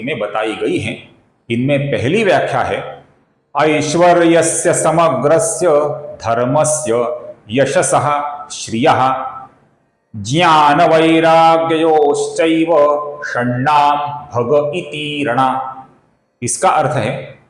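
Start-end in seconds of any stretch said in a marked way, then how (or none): 3.5-15.5 slow, solemn shloka recitation; Sanskrit verse recitation. Acted. Teacher quoting scripture, slow and solemn. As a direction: intone the verse slowly.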